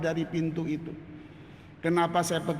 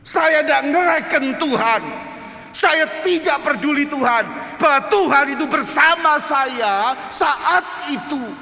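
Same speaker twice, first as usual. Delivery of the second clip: full-force preaching; 12 semitones higher, 8 dB louder than usual. Real, not acted. Preacher admitting he threw God away, impassioned shouting. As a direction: cry out each admission at full volume.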